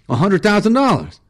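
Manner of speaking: high, loud pleading offer; frantic bargaining; desperate plea. Acted. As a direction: blurt the bribe in a panic.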